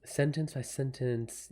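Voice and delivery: soft, slow murmur to herself; slow, hushed thinking aloud; musing to self. Real, not acted. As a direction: turn the phrase over quietly. Mulling the idea over to herself.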